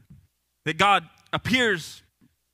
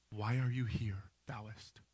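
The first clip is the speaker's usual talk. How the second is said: very soft, low, still small voice; quiet divine question in mock-scripture wording; God; 8 semitones lower, 14 dB quieter, slower than usual. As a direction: barely raise the voice, low and hushed. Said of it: "God speaks in this still small voice".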